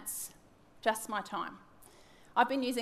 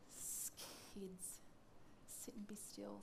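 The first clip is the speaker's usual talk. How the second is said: put-on urgent whisper to the kids; whispered plea for calm; 4 semitones lower, 22 dB quieter, slower than usual. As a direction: hush them without breaking the silence. Hushing the kids during prayer.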